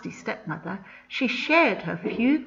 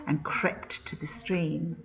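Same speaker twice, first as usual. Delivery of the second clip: hushed storybook whisper, much softer; 4 semitones lower, 7 dB quieter than usual. A narrator's reading. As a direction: whisper it as if sneaking up. Stepmother sneaking to the stream.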